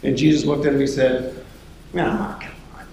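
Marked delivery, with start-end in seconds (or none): 2.0-2.9 mild exasperation. Acted. Jesus sighs at sinking Peter.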